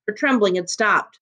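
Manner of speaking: usual speaking voice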